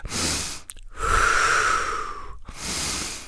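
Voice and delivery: long low breathy exhale; slow unvoiced calming sigh. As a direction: let it all out slowly to calm down. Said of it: "Okay, calming down".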